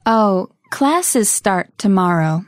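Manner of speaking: slow, carefully spaced reply; slowly. Shown as a demonstration. Telling Mike about classes in practice dialogue, slowly. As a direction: give each word room for learners. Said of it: "one more time slowly".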